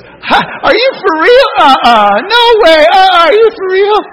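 high, loud, laughing disbelief. Retold or imitated. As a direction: squeal like she can't believe it. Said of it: "She started laughing".

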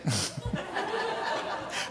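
a sudden high amused giggle; tiny squeaked laugh at himself; high squeaky laugh. Real, not acted. Laughs at his own word.

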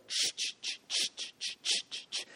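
soft whispered chugging train. Shown as a demonstration. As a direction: puff it out like a train stuck in the sand. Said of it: "that thing starts".

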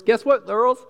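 lightly voiced, high loud teen announcement; a hint of a chirpy fourteen-year-old; teen girl announcing. Mary telling the other girls, lightly voiced teen excitement. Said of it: "imagine her going around to all the other".

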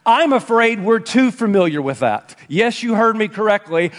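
ordinary delivery

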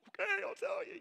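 very high, loud squeak; squeaky panicked caving-in, hammed up; mock cracking confession. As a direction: give in with a comic high-pitched squeal. Acted reply to mother's interrogation.

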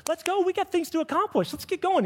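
high, hurried impatient voice; cartoonishly high impatient pushing. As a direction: whine like a restless kid eager to go. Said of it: "Here's us".

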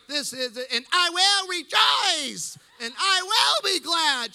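loud, high, drawn-out church shouting; Pentecostal fervor. Retold or imitated. Mother turning Pentecostal at deathbed, fervent shout. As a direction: build to a slow, shouted Pentecostal proclamation. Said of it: "She turns into a Pentecostal".

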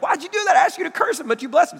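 high, exasperated mock outburst as Balak; frustrated king. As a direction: squeal it out like a fed-up king. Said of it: "Balak gets all frustrated".

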